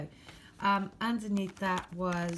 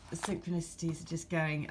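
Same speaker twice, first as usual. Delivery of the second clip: ordinary delivery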